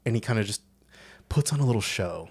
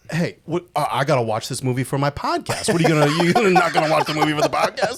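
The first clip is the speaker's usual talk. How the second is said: louder, half-laughing pleading; mock-beg like Connie would; teasing a friend in a Connie whine; 7 semitones higher, 8 dB louder than usual. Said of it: "So you did a Connie thing".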